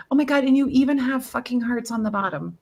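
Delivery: ordinary talk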